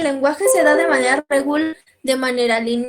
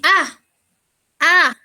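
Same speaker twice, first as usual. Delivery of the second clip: put-on higher, louder baby "ah, ah" while pointing; baby grunts; 4 semitones higher, 3 dB louder than usual. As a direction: grunt for something like a toddler. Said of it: "va a decir". (bebé pidiendo con "ah, ah" agudos)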